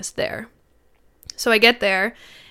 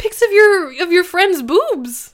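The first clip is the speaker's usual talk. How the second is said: outraged; 8 semitones higher, 4 dB louder than usual. Retold or imitated. Confronting boyfriend about naked photo.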